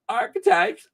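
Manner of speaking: "archetypes" jumped up high in a squeal; playful high pitch. Pretend. Repeated word, playful chant.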